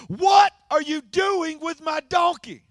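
high, loud, drawn-out shout; angry owner's yell. Acted. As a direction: yell it like the outraged donkey owner. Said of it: "he's gonna yell at you".

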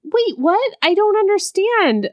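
high, whiny disbelief, pitched well above own voice; mocking the confused boyfriend. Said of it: "And so then he's like".

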